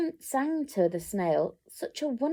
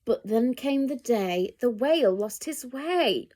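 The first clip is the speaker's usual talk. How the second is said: usual speaking voice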